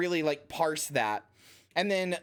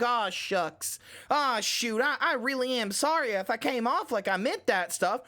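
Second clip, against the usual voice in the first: lightly voiced bashful cowboy apology; a hint of an aw-shucks cowboy, hat in hand; sheepish cowboy; 5 semitones higher than usual. Streamer reading a banned chatter's cowboy-style apology. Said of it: "walking up to me like a cowboy with his hat in hand".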